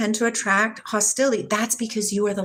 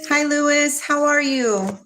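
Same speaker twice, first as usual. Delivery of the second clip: usual speaking voice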